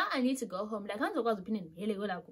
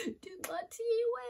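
high, slow mock wail; hammed-up tearful complaint to mom; dramatic retold upset; 13 semitones higher, much slower than usual. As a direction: draw it out in a high, pitiful voice. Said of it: "as dramatic as it was".

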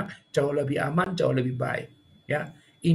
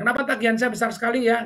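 higher, louder, fast complaint; worried customer; 7 semitones higher, 5 dB louder, faster than usual. Retold or imitated. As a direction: ask fast and anxious about the bill. Customer asking about high bill.

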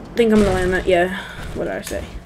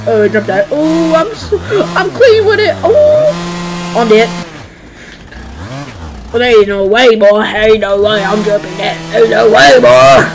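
excited; 7 dB louder than usual. Real, not acted. Hyped big jump.

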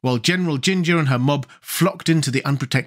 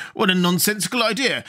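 high-pitched, surprised dismissal; 6 semitones higher than usual. A narrator's reading. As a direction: scoff in surprise, voice lifted. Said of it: "returned the surprised guardian".

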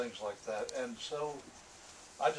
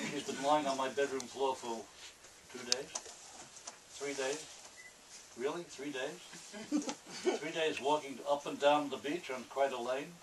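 a hint of a brooding noir narrator; noir monologue reading. Author reading his noir piece aloud, lightly brooding. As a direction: muse aloud like a hard-boiled confession.

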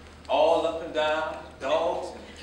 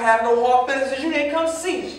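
raised plea; 5 semitones higher, 7 dB louder than usual. Acted. Son pleading during argument.

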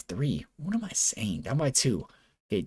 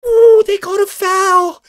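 shocked yell; 13 semitones higher, 6 dB louder, slower than usual. Real, not acted. Shocked by foul call.

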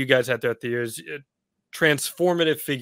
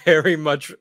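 ordinary delivery

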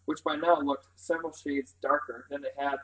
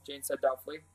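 ordinary delivery, nothing marked

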